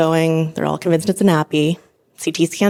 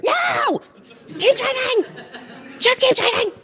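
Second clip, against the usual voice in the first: much higher half-laughing frantic yell. Retold or imitated. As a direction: plead frantically to keep driving. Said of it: "everything inside me is screaming".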